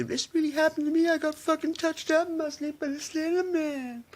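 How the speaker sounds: high, squeaky mock victim voice; mocking scared victim. Mocking gullible people who claim Slender Man got them, high squeaky voice. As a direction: whine it out like a spooked gullible believer. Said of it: "people have been going out".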